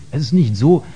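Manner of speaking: usual speaking voice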